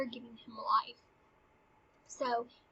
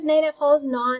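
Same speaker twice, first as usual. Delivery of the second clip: louder, pointed correction; loud retort; 2 semitones higher, 10 dB louder than usual. Acted. Hamlet to Polonius, repeating the line: louder and pointed. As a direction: push it at him louder and firmly. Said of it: "he also knows that Polonius is using Ophelia".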